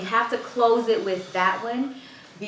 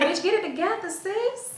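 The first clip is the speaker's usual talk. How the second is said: high-pitched sassy telling-off; playfully cuss yourself out in a much higher voice; mock self-scolding; 12 semitones higher than usual. Host demonstrating cussing herself out, playful high-pitched scolding. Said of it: "You cuss yourself out sometimes?".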